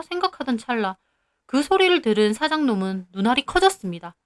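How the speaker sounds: ordinary delivery, nothing marked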